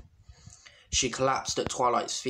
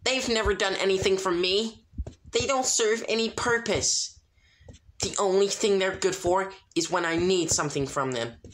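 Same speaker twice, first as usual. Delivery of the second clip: angry shout; 8 semitones higher than usual. A narrator's reading. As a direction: spit out her contempt for friends. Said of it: "Sunset shouted".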